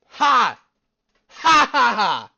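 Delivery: loud, high, forced "ha ha ha"; mocking laugh. Viewer laughing at the boring video, mocking. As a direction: bark out a derisive fake laugh. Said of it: "I'm still gonna laugh at you".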